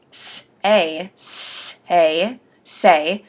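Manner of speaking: "Say" with the S drawn out and separated from the vowel; over-enunciate "say", stretching the opening S; slow, deliberate articulation drill on "Say"; over-enunciating. Shown as a demonstration. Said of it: "practice over enunciating".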